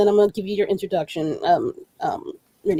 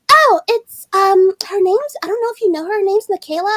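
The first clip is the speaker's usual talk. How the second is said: high, halting made-up voice; put-on friend voice; 8 semitones higher than usual. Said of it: "I'm gonna give her this voice".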